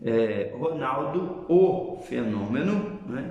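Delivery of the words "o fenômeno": slow o fenômeno, leaning on o; slow, weighted o fenômeno; emphatic article. Shown as a demonstration. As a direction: linger on o fenômeno as the one and only. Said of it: "eu tô utilizando uma entonação diferente".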